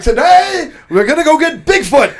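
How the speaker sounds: mock emperor. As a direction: announce it like a grand king. Mock emperor declaring war.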